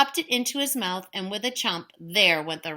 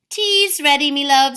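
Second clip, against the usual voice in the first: loving wife; 6 semitones higher, 8 dB louder than usual. Acted. Una calling husband.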